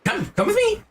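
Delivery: a very high, bouncy insect merchant; grasshopper shopkeeper. Acted.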